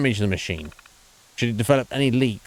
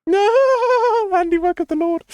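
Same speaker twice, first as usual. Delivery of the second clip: mocking falsetto; 5 dB louder, slower than usual. Mocking the professor's words.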